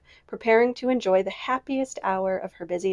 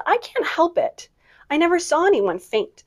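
high protest; 8 semitones higher than usual. Acted. Amy protests during rehearsal.

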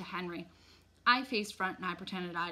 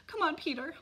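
high-pitched hurried call; anxious, high summons; urgent mother; 9 semitones higher than usual. A narrator's reading. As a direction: chivvy your son along. Upset mother hurries her son along.

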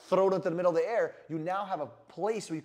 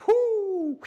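loud, high whoop of triumph; excited whoop; 13 semitones higher, 9 dB louder than usual. Real, not acted. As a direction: cheer out loud like you just closed the sale. Excited after sales pitch.

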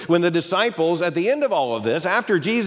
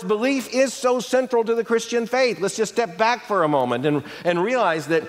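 ordinary talk

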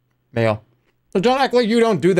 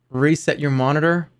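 ordinary talk, nothing marked

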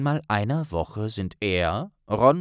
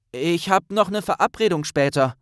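stammering; 7 semitones higher, 4 dB louder than usual. Acted. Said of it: "stammelte er".

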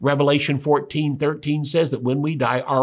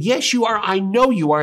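much higher, emphatic accusation; insistent contradiction; 7 semitones higher, 4 dB louder than usual. Acted. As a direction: press the accusation in an acted quarrel. Girl insists again, acted quarrel.

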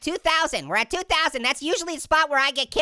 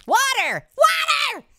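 rising to a high excited yell; excited shouting; 9 semitones higher, 3 dB louder, much slower than usual. Real, not acted. Gamer gets carried away over water, excited shouting. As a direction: yell it higher the second time. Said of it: "Sorry, I got excited".

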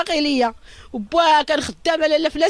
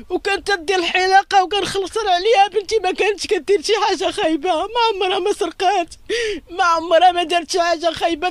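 crying; 5 semitones higher, 3 dB louder than usual. Real, not acted. Mother telling the reporter about her assaulted daughter, crying. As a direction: voice breaking, pitched higher and wailing.

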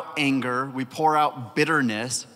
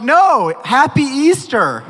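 high, loud, drawn-out cheer; excited holiday greeting; 10 semitones higher, 7 dB louder, slower than usual. Retold or imitated. Teens surprising their leader with Easter treats, excited shout. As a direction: imitate gleeful well-wishers calling out.

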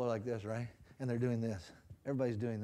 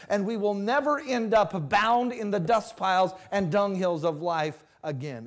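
high, loud sermon building to full force; impassioned preaching; 8 semitones higher, 11 dB louder than usual. Real, not acted. Preacher telling congregation God changed their future, impassioned preaching. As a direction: preach it in a driving, mounting crescendo.

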